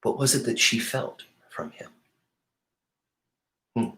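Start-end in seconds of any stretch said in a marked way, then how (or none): none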